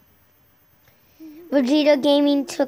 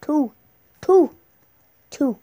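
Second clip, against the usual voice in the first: Two repeated, louder and higher on the second; Two said again and again, pushing harder each time; insisting on two; 3 semitones higher, 7 dB louder than usual. Real, not acted. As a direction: hammer the word Two. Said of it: "we have two guests here".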